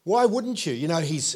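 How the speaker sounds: ordinary delivery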